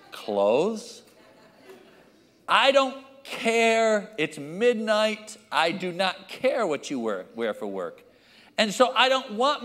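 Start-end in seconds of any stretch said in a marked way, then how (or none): 0.1-1.0 low, drawn-out incredulous one-word reply. Acted. Father woken by a silly midnight question, incredulous. 2.5-5.4 high, slow exasperated protest. Acted. Father woken at midnight by his kid, exasperated.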